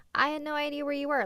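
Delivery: high, fast, sing-song parody of a fan message; mock clueless commenter. Streamer mocking fans' excuse in a high, fast voice. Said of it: "messages that are like".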